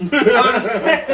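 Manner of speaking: slow, loud words through laughter; can barely get it out laughing. Real, not acted. Laughing while saying it.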